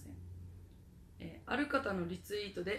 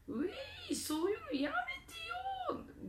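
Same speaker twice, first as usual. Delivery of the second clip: put-on very high, whiny little boy; grubby schoolboy voice. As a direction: squeak it like a bratty schoolboy. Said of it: "小汚い小学生男子のような声".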